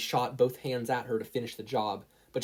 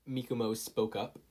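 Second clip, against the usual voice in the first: ordinary delivery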